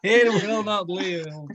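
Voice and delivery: drawn out, weighty; each word given weight; slow solemn repeat. Acted. Spirit repeats promise slowly.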